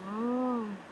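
wincing from cold slushie; brain freeze. Real, not acted. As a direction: hold your head, mild pain. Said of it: "아, 머리 띵해".

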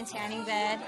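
trying on an American accent; tentative attempt at an American accent; imitated American accent. Shown as a demonstration. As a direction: copy an American housemate's accent. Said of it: "Let me try and do yours".

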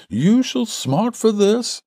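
very high, slow, drawn-out threat; enraged cry. A narrator's reading. Visitor thrown out threatens the farmer, enraged. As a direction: threaten in a high-pitched rage.

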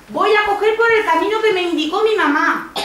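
put-on high little-girl voice for Caperucita; high-pitched storybook child. Voicing Caperucita to the wolf.

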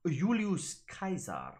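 "Julius Caesar" said yoo-lee-oos kai-sar, slowly; the classical way, with a hard K and an AI sound; classical Latin pronunciation. Shown as a demonstration. Teacher demonstrates how Caesar said his own name, slowly. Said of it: "would have pronounced his own name".

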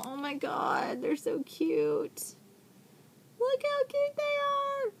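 thin, high squeal at cuteness; real excitement. Real, not acted. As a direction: squeal with delight, climbing very high. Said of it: "I'm so excited".

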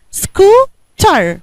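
"سكوتر" in two beats: scoo, ter; split into syllables. Shown as a demonstration. Teacher gives pupil the last word, "سكوتر" syllable by syllable. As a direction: break "سكوتر" with a pause before the last syllable. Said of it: "قسمتيها على كام سيلابل".

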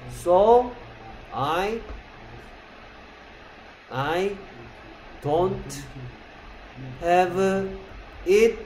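very slow, word by word English example; teacher reading the example slowly for the class; slow model reading. Shown as a demonstration. Teacher to class, slow drawn-out example sentence. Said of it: "teacher models the example sentence slowly".

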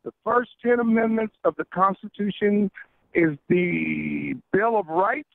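ordinary delivery

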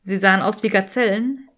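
ordinary delivery

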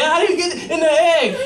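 high-pitched comic urgency; frantic question. Retold or imitated. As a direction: ask like a panicked racer mid-sprint. Racing cell frantically asks.

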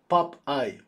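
Popeye sounded out as POP-eye; English pronunciation. Shown as a demonstration. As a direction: say Popeye in two separate parts, pop, eye. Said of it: "the way to pronounce it".

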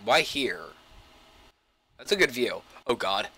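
ordinary talk, nothing marked

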